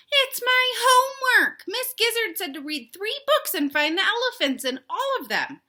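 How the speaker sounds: high, loud chicken protest. Acted. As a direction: insist like a little chicken.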